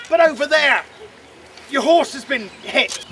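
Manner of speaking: ordinary talk, nothing marked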